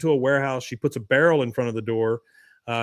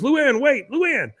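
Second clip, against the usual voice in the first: high-pitched call after someone; mock panicked cry to stop her; urgent calling out; 11 semitones higher, 3 dB louder, slower than usual. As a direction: call out high, chasing someone who runs off. [name] calls after fleeing Luann.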